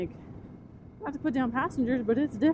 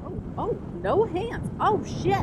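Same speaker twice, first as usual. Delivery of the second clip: much higher, rising gasps of alarm; startled surprise; 9 semitones higher, slower than usual. Real, not acted. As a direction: react to a rider taking both hands off. Rider lets go of handlebars.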